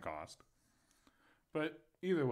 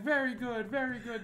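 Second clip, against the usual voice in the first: approving masseuse mimicry; 11 semitones higher, 4 dB louder than usual.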